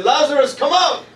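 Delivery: high call to the tomb. Shown as a demonstration. Pastor leading church in calling Lazarus out, high call. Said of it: "Everybody say".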